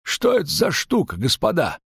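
high-pitched, startled question; astonished, pitch leaping up; alarmed innkeeper. A narrator's reading. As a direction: ask the gentlemen in high alarm.

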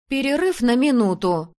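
громкий, высокий сердитый выкрик; огрызнуться сердито и громко; сердитый ответ. A narrator's reading. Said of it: "сердито ответила я". (сердито, на повышенных тонах)

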